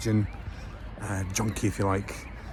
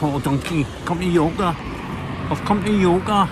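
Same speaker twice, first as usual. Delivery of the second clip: louder, higher comic Glaswegian junkie; Scottish sketch character; 6 semitones higher, 5 dB louder than usual. Acted. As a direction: do a broad Glaswegian comedy impression, voice pushed up. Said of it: "That's my best impression".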